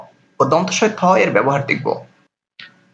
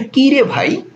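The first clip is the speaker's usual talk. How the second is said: playful exclamation; 7 semitones higher, slower than usual. Pretend. Teacher mock-exclaiming to students.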